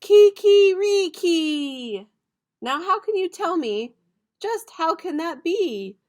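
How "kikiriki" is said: high, loud, slow kikiriki crow; loud rooster cry; Spanish rooster crow. Shown as a demonstration. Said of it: "roosters cry".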